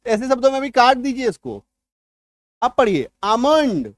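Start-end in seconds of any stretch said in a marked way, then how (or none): 3.2-3.9 आमंड said with no l, slow; silent l almond. Shown as a demonstration. Teacher models आमंड for students, l silent. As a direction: say आमंड as aa-mand, the l dropped. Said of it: "आलमंड इसको नहीं पढ़िएगा".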